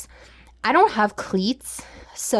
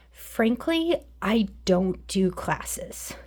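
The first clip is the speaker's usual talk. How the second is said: deadpan; 6 dB quieter, much slower than usual. Real, not acted. Dry aside about classes.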